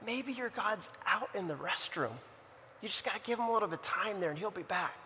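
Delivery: softly mocking, as if confiding something cheeky; hushed mock-reassurance to taunt a rival; sly teasing. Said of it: "starts to have fun with them".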